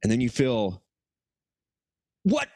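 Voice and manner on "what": high-pitched acted panic; alarmed victim. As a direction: play sudden shock at being strangled. Victim being strangled in the story.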